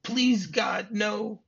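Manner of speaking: high-pitched, hammed-up terrified pleading; mock hysterical begging, voice pushed way up; imitated panicked begging. Retelling Hans acting scared for John, high mock-panicked pleas. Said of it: "acting very scared".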